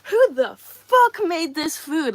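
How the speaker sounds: loud, slow, high demanding yell; mock angry. Pretending to yell at a street vendor in anger. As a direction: bellow it at the vendor like you're outraged. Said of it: "in, like, an angry way".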